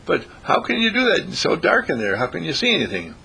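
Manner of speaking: high, questioning rabbit voice; incredulous rabbit. Acted. Rabbit questions caterpillar about the dark cocoon, incredulous and high. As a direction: start high, like a baffled rabbit.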